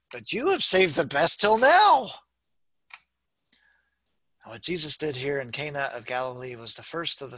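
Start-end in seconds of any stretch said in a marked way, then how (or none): 0.1-2.1 amazed exclamation. A narrator's reading. Pastor reads banquet master to bridegroom, amazed exclamation. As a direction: voice climbing high on the praise.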